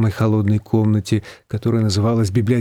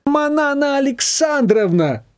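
much higher, slow outraged echo; indignant; 13 semitones higher, slower than usual. A narrator's reading. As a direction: throw the formal name back in disgust. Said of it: "возмутилась свекровь".

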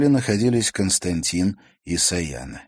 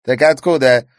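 mocking girl; 10 semitones higher, 9 dB louder than usual. A narrator's reading. Sayana continues.

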